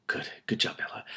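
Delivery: soft whisper of praise; whispered encouragement. Real, not acted. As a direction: cheer her on under your breath. Aside encouraging the character.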